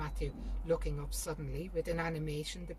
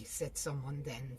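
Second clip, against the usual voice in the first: ordinary delivery, nothing marked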